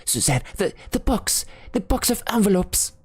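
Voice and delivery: panicked father stammering about the lost box; choking stammer. A narrator's reading. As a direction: choke on the words in alarm. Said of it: "He stammered in a choking voice".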